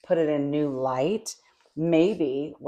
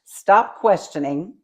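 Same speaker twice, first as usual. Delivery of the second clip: loud, slow, higher-pitched order; emphatic command; 4 semitones higher, 8 dB louder than usual. Acted. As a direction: lay down a firm command. Voices the verse as another command.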